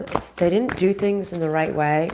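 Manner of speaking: ordinary delivery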